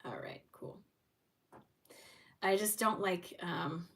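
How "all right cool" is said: whispered to herself, much softer; whispered self-talk after a chore; quiet aside. Real, not acted. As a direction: breathe it almost silently. Off-mic aside after finishing refund.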